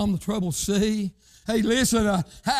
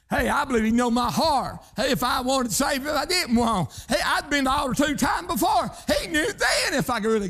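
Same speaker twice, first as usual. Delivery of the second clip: preaching; 8 semitones higher than usual. Acted. Preacher builds again.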